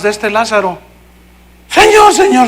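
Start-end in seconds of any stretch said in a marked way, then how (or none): 1.7-2.5 high-pitched cry to the Lord; imitated urgent plea. Sisters run out pleading to Jesus. (súplica aguda e imitada)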